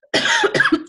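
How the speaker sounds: sharp throat-tickle cough; real cough. Real, not acted. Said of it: "excuse me for the coughing".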